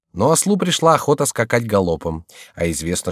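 ordinary delivery, nothing marked

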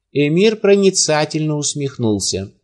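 usual speaking voice

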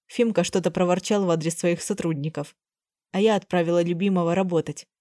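ordinary delivery

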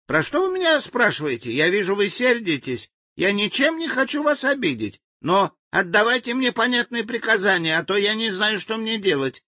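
high, humble native voice; meek reply. Acted. Frightened native begs the angry general for clear orders, meekly. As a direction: plead meekly to be understood. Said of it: "тихо молвил он и покорно".

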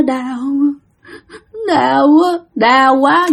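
lightly acted moan of pain; ghost in pain. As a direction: moan it in halting pieces, as if hurting. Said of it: "dạng như đau". (giọng ma rên đau)

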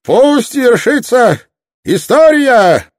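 very high, loud theatrical cry; ringing, hammed-up shout, far above normal pitch; exclaimed toast. A narrator's reading. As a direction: proclaim it over a laughing crowd. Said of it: "Затем он воскликнул среди всеобщего хохота".

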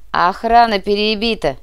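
brisk, upbeat battle report; cheerful report. A narrator's reading. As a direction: call out good news to the boss. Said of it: "весело сообщил Ржавый".

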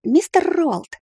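high, hesitant call to the mage. Acted. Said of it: "позвала я чернокнижника".

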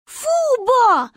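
high, loud "yuck"; high-pitched childish disgust; disgusted little girl. A narrator's reading. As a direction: protest like a grossed-out child. Child disgusted by grandma's suggestion.

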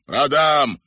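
loud, high dismissive retort; scornful exclamation. A narrator's reading. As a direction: scoff at the very idea. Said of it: "воскликнул Кривой Глаз".

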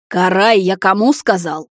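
displeased. A narrator's reading. Elder warns the unruly brother, displeased. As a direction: demand obedience, sternly. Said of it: "недовольно прищурился высший".

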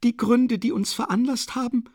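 high-pitched, haughty formal speech; von oben herab. A narrator's reading. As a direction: address him grandly from above. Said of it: "begann sie von oben herab". (herablassend mit hoher Stimme)

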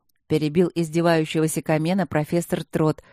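plain reading voice

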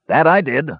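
usual speaking voice

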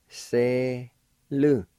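two long, separated syllables; teacher sounding it out slowly. Shown as a demonstration. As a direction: stretch each syllable with a pause between. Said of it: "I'll pronounce these words".

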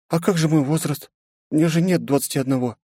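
put-on high young girl voice; girlish voice. A narrator's reading. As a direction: pitch up as a pouty teen. Leni's voice in narration.

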